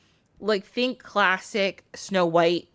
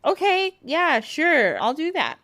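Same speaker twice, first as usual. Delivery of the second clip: lightly voiced high young girl; 8 semitones higher than usual. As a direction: chirp it up high. Vasilia voiced in retold tale.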